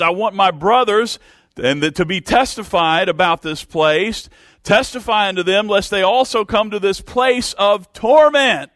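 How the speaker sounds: high, loud pleading cry from hell; desperate cry. Acted. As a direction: beg for mercy at the top of your voice, desperate. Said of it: "He was desperate".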